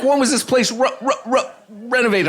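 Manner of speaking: high-pitched stammer stuck on R; mock stutter. Man asking about the property, mock stutter. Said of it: "the stutter kept getting worse and worse".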